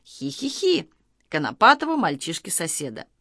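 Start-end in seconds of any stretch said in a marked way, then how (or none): 0.0-0.9 high mock "hee-hee-hee"; imitated giggle. A narrator's reading. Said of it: "раздалось знакомое Баранкину и Малинину". (тоненькое изображённое хихиканье)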